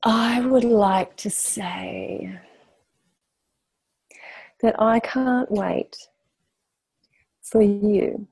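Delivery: very slow with long pauses; slow, deliberate address. Real, not acted. Guest giving last words to everyone, slow and deliberate. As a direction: draw out each phrase, weighing the words.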